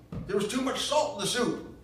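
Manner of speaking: very high, loud mock yell of the old man; Ma mimicking Gramps. Ma imitating Gramps yelling at Gran, very loud mock yell. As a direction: ham up the grumpy husband shouting. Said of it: "he'd more like as not say".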